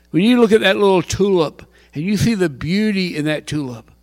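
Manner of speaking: usual speaking voice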